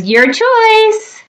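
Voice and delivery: high, drawn-out reply; train character voice. A narrator's reading. Train lets the shark choose the game, high voice.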